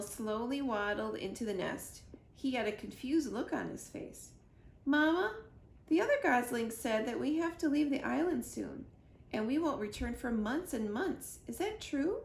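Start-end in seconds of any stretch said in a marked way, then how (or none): 4.8-12.2 high, eager little gosling voice; a small bird asking his mother; gosling asks Mama, high and anxious; young gosling. Acted. Gosling character voice.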